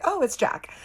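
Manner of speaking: high-pitched little exclamation; pleasant surprise. Retold or imitated. Narrator spots Jack behind the shoulder, pleasantly surprised. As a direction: react to an unexpected friend, pitch up. Relieved spotting of friendly Jack.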